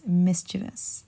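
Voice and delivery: mischievous slowly as MIS-chuh-vus; three-syllable mischievous, stress on MIS; correct mischievous. Shown as a demonstration. Teacher sums up the last word, mischievous as MIS-chuh-vus. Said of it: "but it's actually mischievous".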